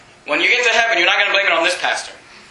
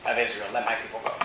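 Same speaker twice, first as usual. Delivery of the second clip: hushed solemn quote; 12 semitones lower, 13 dB quieter than usual. Acted. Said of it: "he got to say those famous words".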